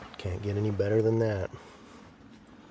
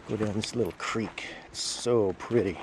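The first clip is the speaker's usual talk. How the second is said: ordinary talk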